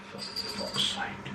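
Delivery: muttered, unvoiced, slow curse; under his breath. Real, not acted. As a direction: say it to yourself, slowly, nearly silent.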